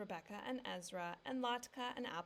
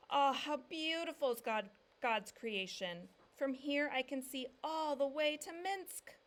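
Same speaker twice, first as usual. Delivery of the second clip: louder, higher, awed, slowed on creation; calling out; 6 semitones higher, 8 dB louder than usual. A narrator's reading. Papa calling from the treetop to his family, awed. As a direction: call down from the branch in wonder. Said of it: "Papa called".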